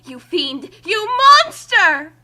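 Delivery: normal talking voice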